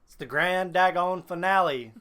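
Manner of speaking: ordinary delivery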